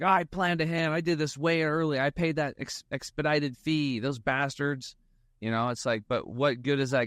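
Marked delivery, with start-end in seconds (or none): 0.0-4.9 high, whiny imitation of griping; half-joking indignant rant; mock grumbling. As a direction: play a petty complaint, lightly hammed. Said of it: "I could be like".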